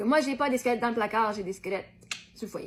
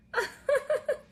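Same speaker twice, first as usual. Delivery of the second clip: high squealing laugh; 12 semitones higher than usual. Acted. Tickled by the skeleton.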